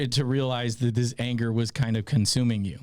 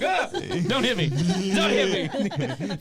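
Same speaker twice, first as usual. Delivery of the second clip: high, laughing plea for mercy; hammed-up frightened victim; mock flinching fear; 6 semitones higher, slower than usual. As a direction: cower comically as if about to be hit. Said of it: "he flinched. She's like".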